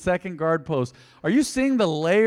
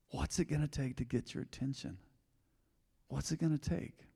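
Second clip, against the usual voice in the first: much softer, searching question; hushed appeal; 12 dB quieter than usual. Real, not acted. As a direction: let it sink in quietly, with pauses. Softly repeating the challenge.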